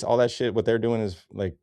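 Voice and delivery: ordinary talk, nothing marked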